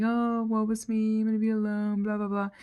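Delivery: wallow in it, imitating your own pity party; mock self-pity. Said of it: "I'm like".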